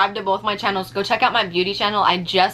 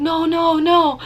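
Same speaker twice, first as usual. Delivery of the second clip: higher, fast, pleading no's; desperate begging; 8 semitones higher, 4 dB louder than usual. Retold or imitated. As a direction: plead in a panic not to go back. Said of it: "I was, like, begging him".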